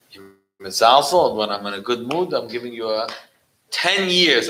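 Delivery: furious threat of punishment; angry prison chief. Retold or imitated. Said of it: "He got angry, became red".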